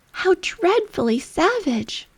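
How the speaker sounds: Alice exclaims in horror at the Queen; shocked exclamation. A narrator's reading. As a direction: gasp it out in disbelief. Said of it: "exclaimed Alice".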